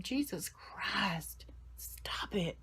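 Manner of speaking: real tearful whisper, very soft and slow; whispering through tears. Real, not acted. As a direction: hold back crying, barely voicing the words. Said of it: "I'm gonna cry again".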